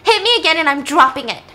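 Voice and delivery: loud, high, defiant threat; threatening. Acted. Daughter threatens dad over his bottle, loud defiance. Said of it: "threatens him".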